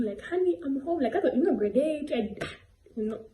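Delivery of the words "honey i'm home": high mock "Honey, I'm home" call; playful greeting. Joking to friends at school, high playful homecoming call. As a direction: sing it out like coming home to a spouse. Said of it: "I used to just do".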